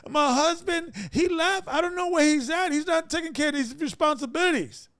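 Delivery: high, crying complaint; mock a tearful wife telling the reverend; whiny wife voice.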